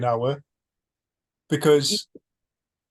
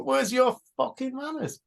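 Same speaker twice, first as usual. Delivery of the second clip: mock road rage; 10 semitones higher than usual. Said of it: "go up to them and go".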